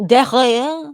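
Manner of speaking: mock French accent. Trading French pronunciations of derriere.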